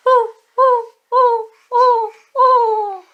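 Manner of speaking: high hooting ooh calls; animal call. Shown as a demonstration. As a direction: hoot it high and loud. Animal sound demo for toddler.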